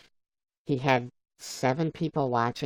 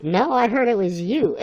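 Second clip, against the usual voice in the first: higher, louder playful accusation; imitating Bob; 7 semitones higher, 6 dB louder than usual. Bob blaming the streamer, imitated higher and louder. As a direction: toss the blame straight back. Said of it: "He's like".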